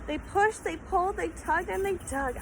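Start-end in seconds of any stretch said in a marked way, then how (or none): none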